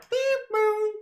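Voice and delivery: very high door beep; door chime. Pretend. Finishing the mock train bit, high door chime. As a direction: chime like closing train doors. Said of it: "door sound effect".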